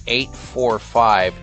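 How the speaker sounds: ordinary talk, nothing marked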